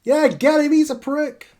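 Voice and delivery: high, loud voices from the crowd; cheering supporters. Acted. Fans back the wrestler against the heckler, high jeering shouts. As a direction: egg them on in a high crowd voice. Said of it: "people who were cheering you are clapping".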